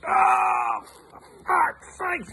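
very high-pitched strained yell; acted agony. Kicked man reacting to the farmer's kick, high agonised yell. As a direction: cry out as if kicked in the groin.

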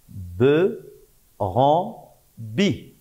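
three syllables held apart; syllabe par syllabe. Shown as a demonstration. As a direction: dictate them one by one, pausing between. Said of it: "Et la troisième syllabe". (syllabes détachées, une par une)

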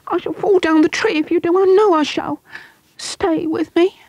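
put-on high panicked boy begging; crying out. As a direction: plead high so he won't be left. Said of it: "the boy cried out at once".

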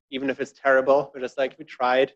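normal talking voice

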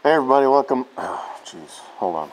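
muffled behind mask; speaking through face mask; through a respirator mask. Real, not acted. Seen 1: Half-face respirator on face while speaking; removed by 5.3.

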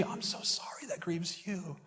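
high, hushed consoling whisper; imitating a fawning comforter, very soft; mock sympathy. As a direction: soothe them in a soft, put-on whisper. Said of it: "people going".

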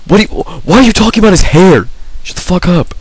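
high, incredulous protest; indignant and high-pitched; exasperated outburst. Real, not acted. Snapping back at a friend's remark.

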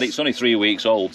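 ordinary talk, nothing marked

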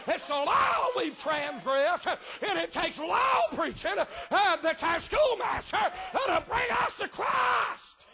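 shouted, high preaching cadence with uh breaths; fiery preaching. Acted. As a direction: preach it at full force, shouting to the back pews.

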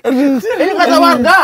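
stalling with a laugh; drawn-out giggly excuse. Real, not acted. Laughing defence.

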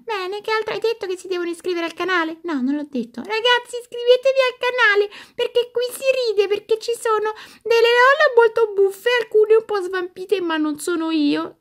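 high, chirpy toy doll voice; squeaky doll voice. Acted. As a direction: play a cheeky little doll. Doll voice continues.

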